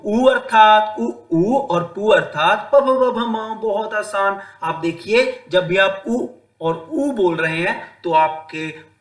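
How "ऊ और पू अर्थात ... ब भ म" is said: ordinary delivery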